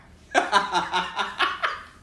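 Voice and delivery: loud, high laugh; real burst of laughter. Real, not acted. Teacher laughing at the boy's bathroom threat, loud laugh.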